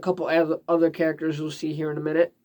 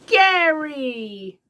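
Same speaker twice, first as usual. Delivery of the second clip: cartoon character call; 8 semitones higher than usual. Voicing SpongeBob calling his snail.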